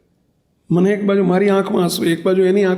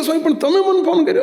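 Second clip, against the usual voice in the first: much higher, incredulous question with a rising end; disbelieving young man; 10 semitones higher than usual. Retold or imitated. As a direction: let the disbelief climb. Young man surprised on phone.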